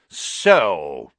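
a hostile aristocrat's sneer; furious nobleman confronting the headmaster; cold Lucius Malfoy. A narrator's reading.

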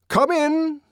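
high, loud summons; commanding. A narrator's reading. Bernard calling through the open door, commanding. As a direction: call it out as an order. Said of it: "he commanded".

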